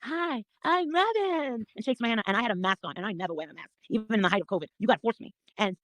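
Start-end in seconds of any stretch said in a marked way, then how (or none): 0.0-1.7 high, slow sugary mock greeting; mimicking Robin. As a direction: drag out a fake-sweet hello. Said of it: "sits right next to me and goes".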